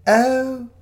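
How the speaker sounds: loud, high quavery cry of wonder; quavery elderly vicar. Acted. Fake vicar admires the big tree, in a high quavery voice. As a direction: coo like a delighted old vicar. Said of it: "in a quavery voice".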